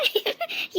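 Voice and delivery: a short breathy snicker, half hidden; sly snicker. Acted. Schemer snickering quietly as the man gets told off. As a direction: snicker behind your hand at his humiliation. Villain gloats as the man is mocked.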